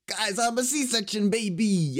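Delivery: a lightly voiced copy of his friend; Peter quote impression. Quoting his friend's line in a put-on slow voice. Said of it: "that's a good Peter quote".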